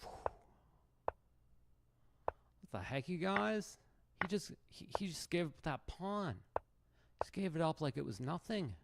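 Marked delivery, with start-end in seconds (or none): 2.6-8.7 much higher, incredulous mock streamer voice; high-pitched disbelieving chess streamer; Hikaru impression. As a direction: squeal it out like an outraged rival player. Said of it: "I believe I'm supposed to be Hikaru".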